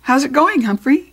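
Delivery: cheery, high and loud greeting; big friendly hello to the hamster; hearty janitor. Acted. Aldo voiced, hearty.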